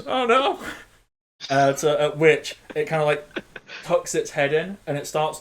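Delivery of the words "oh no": high squealing oh no, then a crying ah; panicked apple snake. Acted. Game master voicing the apple snake hit by moonbeam, high panicked squeal. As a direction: squeal like a terrified cartoon monster. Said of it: "He goes".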